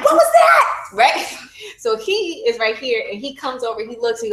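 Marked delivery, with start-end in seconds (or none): 0.0-0.6 very high startled squeal with a laugh. Retold or imitated. Recalling something popping out during labour, a startled squeal. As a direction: shriek it in surprise, laughing. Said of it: "And I was like".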